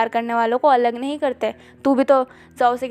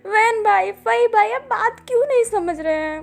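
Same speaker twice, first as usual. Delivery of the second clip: higher, bouncing on the words; bouncy pleading; 6 semitones higher than usual. A narrator's reading. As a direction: hop a little as you plead. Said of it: "ये थोड़ा उछल के बोलती है".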